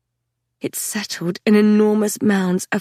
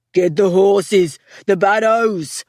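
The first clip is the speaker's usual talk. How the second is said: loud, slow order over the din; shouted order; 4 dB louder, slower than usual. A narrator's reading. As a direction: bark out the order. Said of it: "a voice shouted".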